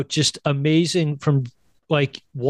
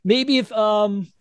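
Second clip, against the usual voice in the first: imitating Whoopi; 7 semitones higher than usual. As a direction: linger on the hopeful offer. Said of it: "She's like".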